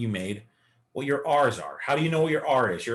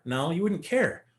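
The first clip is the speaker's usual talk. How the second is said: ordinary delivery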